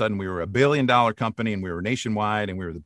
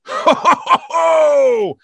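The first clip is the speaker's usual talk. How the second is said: high, loud, slow booming ho ho ho; big Santa laugh; 11 dB louder, much slower than usual. Shown as a demonstration. Voice actor booms the Santa laugh at callback. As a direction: shout it like a jolly Santa. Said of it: "You mean like".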